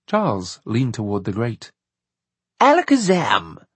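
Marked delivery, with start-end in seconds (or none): 2.6-3.6 high, loud magic-word call. A narrator's reading. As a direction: proclaim it like a spell. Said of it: "he said loudly".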